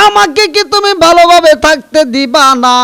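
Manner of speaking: loud, high, rapid outburst; angry reproach. Acted. Son scolds his visiting mother, loud angry outburst. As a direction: explode at her in exasperation.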